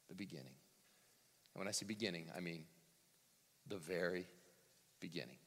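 hushed awe. Acted. Preacher to congregation on the opened heavens, hushed. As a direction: drop to an awed near-hush.

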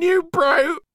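high, loud shocked cry; exclamation. A narrator's reading. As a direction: fling the insult in a high voice. End of previous scene, character shout.

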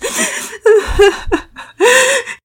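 high, loud acted sobs; child's sobbing. A narrator's reading. Pablo melts into Daddy Peters' arms, sobbing. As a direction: break down into high boyish sobs. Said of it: "He melted into sobs".

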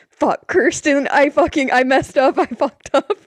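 replaying her own freak-out; panicked. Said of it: "I started panicking".

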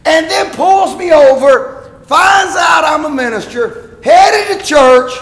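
high, loud, slowed exasperated rant; indignant rant. Retold or imitated. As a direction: drag it out, outraged at the cop. Indignant rant about traffic stop.

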